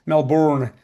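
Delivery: Melbourne said the American way, a full r and a long or; Melbourne drawn out as Mel-born-a. Shown as a demonstration. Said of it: "my American friends".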